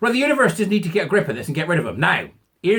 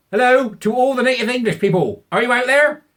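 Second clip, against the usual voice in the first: louder, higher hail to the audience; calling out; 8 semitones higher, 5 dB louder than usual. Real, not acted. Vlogger calling out to native English viewers. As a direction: call out to everyone out there.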